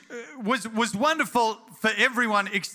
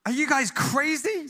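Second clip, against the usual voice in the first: mocking disbelief; 5 semitones higher than usual. Cynical buddy mocks the fishermen.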